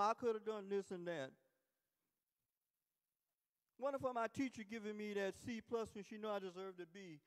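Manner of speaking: loud, indignant mock excuse-making; blaming complaint. Preacher mimicking folks who blame family and teachers, loud complaint. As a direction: complain loudly as someone pinning it on others.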